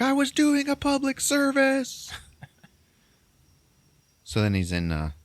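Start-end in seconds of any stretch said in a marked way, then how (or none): 0.0-2.1 high, drawn-out protest; hammed-up indignant cry under arrest; protesting yell. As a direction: plead your case in a slow, pitched-up holler. Protesting while being arrested.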